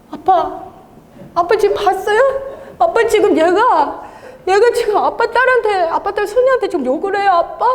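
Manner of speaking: high, laughing little-daughter whine; tattling to daddy. Retold or imitated. Daughter complaining to God about the demon, mock tattling. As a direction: tell on someone like an indignant child, hammed up. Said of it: "하나님 아버지한테 제가 일렀잖아요".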